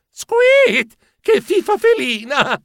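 spooky ghost shriek; squeaky ghost. Acted. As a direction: taunt the scared mouse like a shrieking spook. Ghost cackles at the mouse.

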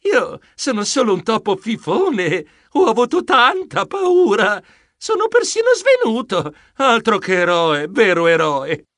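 flustered, stretched-out protest climbing high; embarrassed modesty turning to high whine; bashful. A narrator's reading. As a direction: squirm away from the praise. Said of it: "Io risposi arrossendo".

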